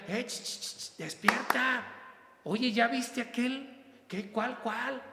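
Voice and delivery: high-pitched hushed call to wake up; urgent nudging. Acted. Jesus elbows you to look around, high urgent nudging. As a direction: nudge a dozing friend with a high, urgent aside.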